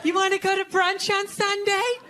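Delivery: a hint of a sweet sing-song invitation; mock girlfriend voice. Girlfriend inviting her boyfriend to brunch, lightly sing-song. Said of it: "they'll be like".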